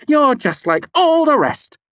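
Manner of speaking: very high, loud; furious. A narrator's reading. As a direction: condemn him. Denna furious.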